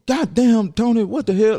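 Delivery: loud, high startled yell; King of the Hill yell. Retold or imitated. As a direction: bellow like a cartoon Texan dad hit by a branch. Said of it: "he sounded like, uh, uh, King of the Hill".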